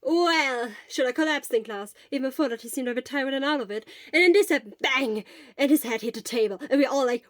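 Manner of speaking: fast, loud, breathless rush of words; gushing. Acted. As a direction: let it all gush out at once. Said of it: "words gushed out of Hisashi".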